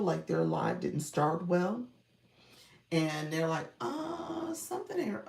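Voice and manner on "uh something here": high-pitched drawn-out mock hesitation; high, stretched "uh" of doubt; hesitant doubt. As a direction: imitate an unsure streamer, voice way up high. Quoting streamers who doubt their live.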